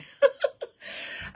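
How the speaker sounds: bright high giggle at herself; real high laugh. Real, not acted. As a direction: burst into a high airy laugh. Laughs at own twaddle remark.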